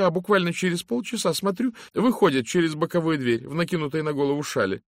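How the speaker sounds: a gossipy housekeeper confiding; brisk secretary voice. Acted. Miss Brewis continues her report. (голос чопорной секретарши)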